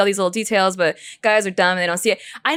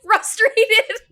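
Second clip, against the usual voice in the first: hammed-up furious warning, laughing; mock yelling; 11 semitones higher than usual. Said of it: "I'll even call it out as I'm mad".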